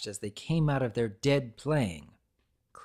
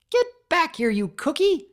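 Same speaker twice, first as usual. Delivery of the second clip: high, loud furious shout after a runaway cookie; angry yell; 7 dB louder than usual. A narrator's reading. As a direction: yell it like you're chasing a thief. Pinkie chasing the cookie.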